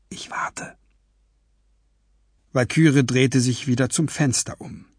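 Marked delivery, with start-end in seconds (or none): 0.1-0.8 very soft whisper. A narrator's reading. She promises Fletcher she will wait, whispering. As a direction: breathe it out without voice. Fletcher leaves, others hiding.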